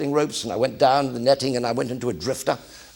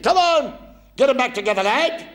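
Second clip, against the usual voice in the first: barking petty officer; 12 semitones higher than usual. Retold or imitated. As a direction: bellow it like a feared chief petty officer. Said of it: "He said".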